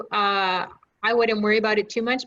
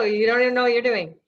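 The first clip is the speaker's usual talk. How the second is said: teasing; 3 dB louder, faster than usual. Shown as a demonstration. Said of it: "I'm kidding".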